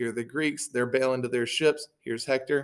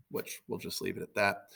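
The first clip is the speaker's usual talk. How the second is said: ordinary delivery, nothing marked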